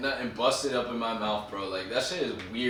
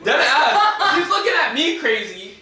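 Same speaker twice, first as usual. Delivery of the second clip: much higher and louder, laughing through the words; laughing hard; 12 semitones higher, 10 dB louder than usual. Real, not acted. Cohost laughing as he calls her out for joking about crashing the wedding, loud and high. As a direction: crack up while telling on her.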